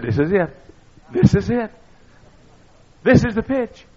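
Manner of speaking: high, loud building anticipation; preacher telling his friend the pitch is coming; urgent excitement. Retold or imitated. Urging his friend at the replay.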